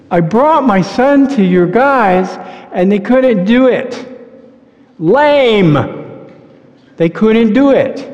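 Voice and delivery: high, slow, aggrieved complaint from the father; father griping that the disciples failed, high and whiny; complaining father. Acted. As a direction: whine it like a fed-up dad with his sick son.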